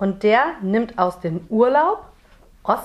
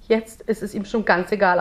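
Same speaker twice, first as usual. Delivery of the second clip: plain reading voice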